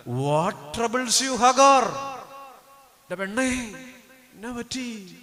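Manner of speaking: slow, drawn-out and gentle, then a high swell on the name; God gently asking Hagar what is wrong; tender divine voice. Acted. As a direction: comfort a weeping daughter. God asks weeping Hagar.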